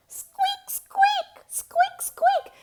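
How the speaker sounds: very high tiny squeaks; piping mouse squeaks. A narrator's reading. Mouse arrives in story.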